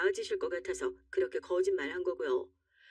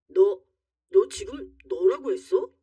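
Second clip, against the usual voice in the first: loud, indignant challenge; affronted; 7 dB louder, slower than usual. Acted. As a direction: challenge the insult at raised volume. Husband affronted at being addressed rudely.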